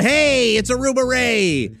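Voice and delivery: high, loud, smarmy bar-owner greeting; Aruba Ray voice. Shown as a demonstration. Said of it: "dip into this character named Aruba Ray".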